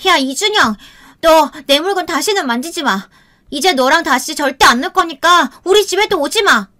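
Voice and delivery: put-on a little girl finally yelling back; child's yell. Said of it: "소리를 질렀어요".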